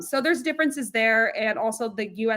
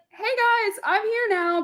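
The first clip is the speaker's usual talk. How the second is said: much higher, bright newcomer's hello; a team announcing itself, pitched high in mock excitement; mock eager greeting; 11 semitones higher, slower than usual. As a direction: play the overeager new kid waving in. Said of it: "you're like".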